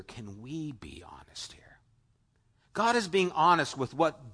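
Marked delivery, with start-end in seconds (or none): 0.0-1.6 hushed unvoiced aside, much softer; whisper. Real, not acted. Preacher whispers an honest aside to the congregation, hushed. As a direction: lean in and whisper it to the room.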